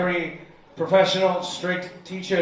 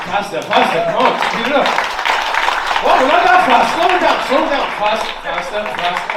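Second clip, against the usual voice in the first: hyping the crowd; 4 semitones higher, 9 dB louder than usual. Real, not acted. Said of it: "Can I get a slow clap going?".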